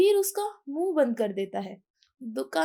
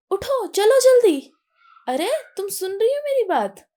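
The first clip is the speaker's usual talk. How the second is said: urgent shout; 7 semitones higher, 10 dB louder than usual. A narrator's reading. Veer urging frozen girl, tiger near.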